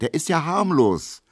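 hoch und laut gerufen; rufen. A narrator's reading. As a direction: ruf es beschwichtigend in die Menge. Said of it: "ruft jemand". (hoch gerufener Zuruf aus der Menge)

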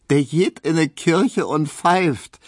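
high, slow, mock-pitying Berlin quip; drawn-out teasing jibe with a smile; amused teasing. A narrator's reading. Hedwig mocking timid Emil, slow and high teasing. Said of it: "halb nachgebend".

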